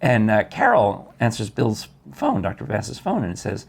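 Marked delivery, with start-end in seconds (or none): none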